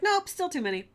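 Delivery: high, loud, breezy dismissal as God; mock God voice. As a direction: toss it off like an offhand deity. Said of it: "And God is like".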